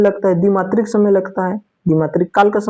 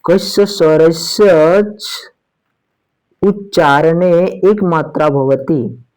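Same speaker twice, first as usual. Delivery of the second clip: slow recited Sanskrit question; Sanskrit recitation; much slower than usual. Acted. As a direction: recite it slowly, word by word.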